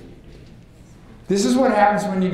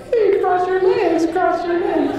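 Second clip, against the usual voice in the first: squeaky high little voice, much higher; mirror neurons talking; 13 semitones higher than usual. Pretend. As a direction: voice tiny neurons urging cross your legs. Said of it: "their little mirror neurons go".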